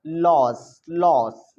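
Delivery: "लॉस" with a long open aw vowel; open vowel demo. Shown as a demonstration. Teacher modelling for pupils to repeat, "लॉस" with open aw. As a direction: say "लॉस" loud and drawn out, open O. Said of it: "जैसे अ पढ़ेंगे, अ, बोलो लॉस".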